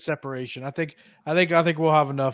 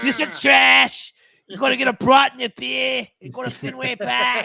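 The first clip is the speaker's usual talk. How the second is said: put-on Boston accent; 7 semitones higher than usual. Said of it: "Your Boston accent came out big time".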